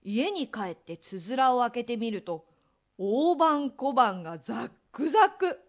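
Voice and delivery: ordinary delivery, nothing marked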